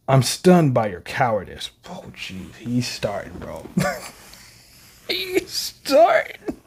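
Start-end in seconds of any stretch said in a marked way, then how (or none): 5.1-6.6 high, slow, stretched squeal; drawn-out excitement. Real, not acted. As the fight escalates, slow high squeal. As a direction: stretch it out in giddy disbelief.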